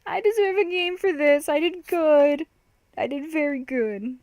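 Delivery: put-on high, pleased-with-himself boy voice; mock boastful little-kid voice; proud kid. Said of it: "he's so proud of it".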